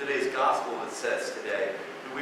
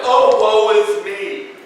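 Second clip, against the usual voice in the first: loud, slow hammed-up wail of woe; mock lament; 6 semitones higher, 10 dB louder, slower than usual. A penitent tearing his clothes, in a mock wail. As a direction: ham up a dramatic grief. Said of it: "an act of".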